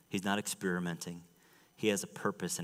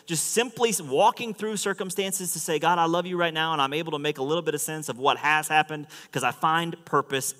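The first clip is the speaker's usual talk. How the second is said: high, loud, driving sermon voice; impassioned preaching; 8 semitones higher, 9 dB louder than usual. Real, not acted. Preacher proclaims God's revelation to the congregation, impassioned preaching. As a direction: preach it out with full force.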